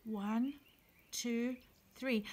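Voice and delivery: usual speaking voice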